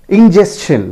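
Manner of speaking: Ingestion high and loud, in-JES-chun; correct pronunciation. Shown as a demonstration. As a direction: punch out S-CH in Ingestion. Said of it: "It is again pronounced as". (Ingestion का सही उच्चारण, ज़ोर से)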